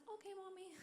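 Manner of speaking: put-on small, very high child voice; little girl. Little girl to her mom, tiny high child voice. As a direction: answer like an obedient little kid. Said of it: "And the girl's like".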